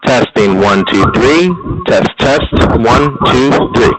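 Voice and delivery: blown-out, clipping mic sound; overmodulated mic. Shown as a demonstration. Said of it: "it sounds way overmodulated".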